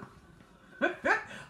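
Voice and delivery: squealing high laugh; high giggle. Real, not acted. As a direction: burst into a shrill giggle. Laughing at the drawings.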